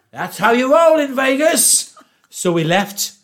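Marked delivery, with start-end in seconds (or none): 0.1-2.0 high, slow swaggering boast; cocky. Retold or imitated. Broke friend shrugging off his loss, slow cocky boast. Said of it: "He went".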